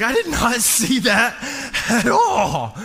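loud, high, hammed-up amazement; acted astonishment. Dad floored by son's easy maze solution, mock astonished. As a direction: exclaim it like a dumbfounded dad.

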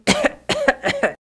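sudden high cough spluttering water; high coughing fit. A narrator's reading. Dax landing in the pond coughs, high spluttering cough. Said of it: "hustete Dax".

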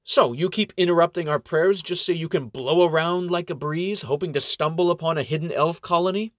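a hint of a prickly little monk; feisty little gnome. A narrator's reading. Umbert voice.

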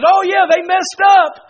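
slow, shouted, high devil taunt; gloating devil. Acted. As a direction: crow over a sinner's slip like the devil. Preacher voices the gloating devil.

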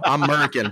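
"American" as "'Merican", no first A; American without the A. Pretend. Host answering the French correction, "American" said as "'Merican". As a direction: say "American" as a mock-patriotic "Murican". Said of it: "Drop the A".

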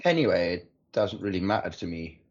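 ordinary talk

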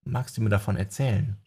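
plain reading voice

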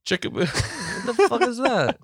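high laughing disbelief. Real, not acted. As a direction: crack up mid-question. Cracks up at silly name.

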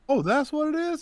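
high, startled retold realization; retold surprise. Realizing why his head hurt.

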